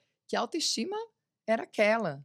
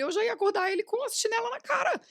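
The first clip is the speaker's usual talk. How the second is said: high, outraged retelling of her outburst; shocked mother-in-law rant; indignant outburst; 4 dB louder than usual. Retold or imitated. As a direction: fire it off, scandalized.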